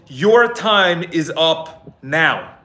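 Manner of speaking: each word drawn out slowly, weighted like a headline; slow, solemn title announcement; slow title read. Shown as a demonstration. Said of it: "this is gonna be titled".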